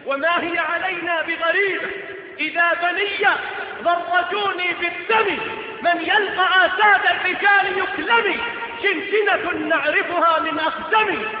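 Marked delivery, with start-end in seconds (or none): 2.4-11.3 loud, proud recited verse; declaimed poetry. Acted. Quoted verse declaimed loudly. (إلقاء شعري حماسي)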